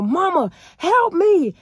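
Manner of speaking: high, loud yell for help; retold scream for help. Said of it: "And I'm calling my mama".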